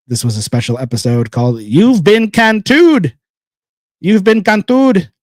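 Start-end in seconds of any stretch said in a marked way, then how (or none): 1.6-5.1 higher, louder, slow announcer-style title; dramatic title drop. Shown as a demonstration. Host tries out episode title, big announcer voice. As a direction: boom out the episode name like a show opener. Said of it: "It sounds kinda weird".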